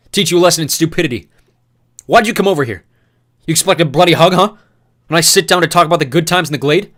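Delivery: loud, high sneering fury; mocking rage. Newt taunting Thomas on top of him, loud mocking rage.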